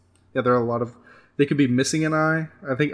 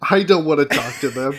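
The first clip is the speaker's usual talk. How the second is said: louder mock-disgusted refusal; mock disdain; 2 semitones higher, 6 dB louder than usual. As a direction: recoil in playful disgust. Reacts as disdainful character, joking.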